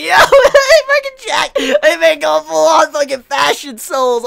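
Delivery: very high, loud shriek of amusement; real laughing, over-the-top fan excitement; hysterical laughing outcry. Real, not acted. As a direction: shout it while cracking up. Cracking up over Fashion Souls moment.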